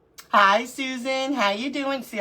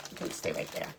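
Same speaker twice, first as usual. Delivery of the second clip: whisper; 10 dB quieter than usual. Real, not acted. Talking aside to a cookie.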